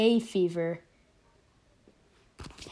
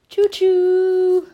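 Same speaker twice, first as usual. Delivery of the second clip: train whistle imitation; 11 semitones higher, 10 dB louder than usual.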